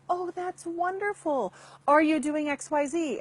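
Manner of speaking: gushing fake-nice praise, pitched high; sweetly enthusiastic, masking a dig; pleasant. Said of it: "comes off as really great, pleasant stuff".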